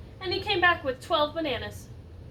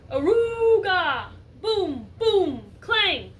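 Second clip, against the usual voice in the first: slow loud Aruga, booms and a clang; loud noise sounds; 4 semitones higher, 8 dB louder, much slower than usual. Acted. Stuck elephant kicking, making loud noises. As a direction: bellow the noises out. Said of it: "The elephant wiggled and kicked".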